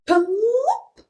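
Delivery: ordinary delivery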